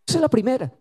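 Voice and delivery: ordinary talk, nothing marked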